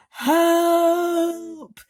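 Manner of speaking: high, loud panicked cry; cry for help. A narrator's reading. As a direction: yell for help as you fall. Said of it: "cried the centipede".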